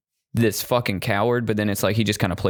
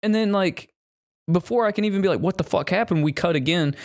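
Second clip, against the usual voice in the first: excited; 9 semitones higher than usual. Real, not acted. Excited movie recap.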